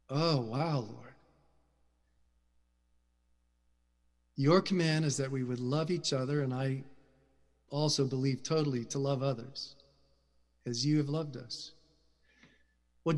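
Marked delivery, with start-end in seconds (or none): 0.1-11.7 soft, low, hushed prayer; quiet prayer. Real, not acted. Preacher softly praying to the Lord after the sermon point.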